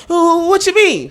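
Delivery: high, slow mock innocence; playing innocent. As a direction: stretch it out, feigning surprise. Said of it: "And he was like".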